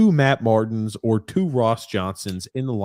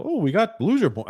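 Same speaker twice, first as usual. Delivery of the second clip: high-pitched mock consolation; mocking imitation; 8 semitones higher than usual. Co-host imitating people excusing a loss, mocking.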